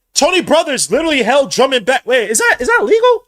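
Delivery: high, loud incredulous outrage; real furious disbelief at the referee; outraged shout. Real, not acted. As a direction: shout the complaint at the ref.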